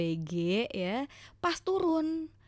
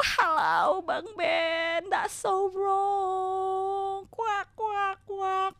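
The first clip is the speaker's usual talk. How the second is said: higher, dragged-out mocking cry; mock wail; 8 semitones higher, much slower than usual. Real, not acted. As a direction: wail at him in mock despair. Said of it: "mocking husband's mistake".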